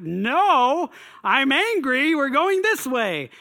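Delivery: high, loud stubborn refusal; retold angry refusal. Angry teen refusing God's nudge, loud and stubborn. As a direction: dig in and refuse God. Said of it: "I'm like".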